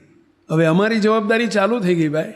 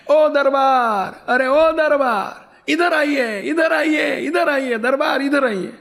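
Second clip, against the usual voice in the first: high, drawn-out cry across the yard; hollered summons, vowels stretched high; calling out; 8 semitones higher, slower than usual. Retold or imitated. Old woman urgently summoning the darbar, calling out. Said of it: "ડોસી એકદમ બૂમ પાડી".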